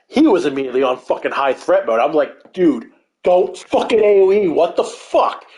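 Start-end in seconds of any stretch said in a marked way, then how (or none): 2.5-5.5 raised, urgent protest at a teammate; half-shouted gamer outrage; flipping out. Retold or imitated. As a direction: snap at him mid-fight. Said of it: "I was flipping out".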